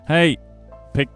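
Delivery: usual speaking voice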